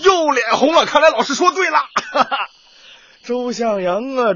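high, loud gleeful gotcha; a teacher triumphantly teasing the blushing boy. Acted. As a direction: crow that you guessed right. Teacher teases blushing pupil.

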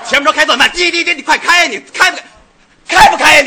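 high, loud driver yelling ahead; mock road rage. Acting road rage at car ahead. (夸张地冲前车大声叫骂)

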